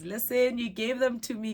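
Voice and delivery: Listen jumps up high and loud; excited exclaim. Real, not acted. To the fan who wants her to have flowers, an excited loud Listen. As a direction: burst out, delighted and protesting.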